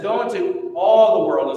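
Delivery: "all" pushed out loud and firm, as if underlined; stress on all. Shown as a demonstration. Preacher quoting Jesus to the congregation, leaning hard on "all". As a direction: lean hard on "all" in "Go into all the world". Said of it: "you're underlining the word all".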